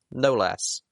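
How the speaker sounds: usual speaking voice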